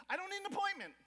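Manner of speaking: high, loud demand at the clinic; insistent parent. Retold or imitated. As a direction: push back hard, refusing to wait.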